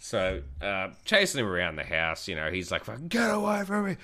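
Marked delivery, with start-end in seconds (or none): 2.8-4.0 put-on high squealing teen yelling to back off; panicked mate. Mate fleeing around the house, high panicked squeal. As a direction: shriek while running away.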